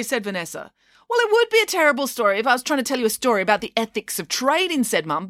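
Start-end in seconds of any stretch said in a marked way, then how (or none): 1.1-4.8 quick retort. Real, not acted. Mum rattles off her defence.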